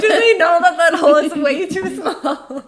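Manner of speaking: laughing mock alarm. Joking about the roller coaster.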